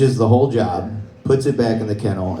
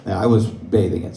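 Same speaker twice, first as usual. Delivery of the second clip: ordinary talk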